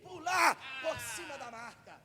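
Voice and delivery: loud, driving pulpit preaching; fiery preaching. Acted. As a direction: preach it out to the whole church. Preaches at full force to congregation. (pregação inflamada e bem alta)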